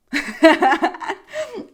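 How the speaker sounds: sudden high burst of laughter. Real, not acted. Awkward moment with guard.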